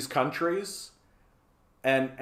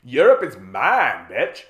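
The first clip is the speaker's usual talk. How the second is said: loud, slow territorial put-down; hammed-up tough refusal; possessive United States; 6 dB louder, slower than usual. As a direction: play a jealous bully staking a claim. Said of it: "the United States is basically just going".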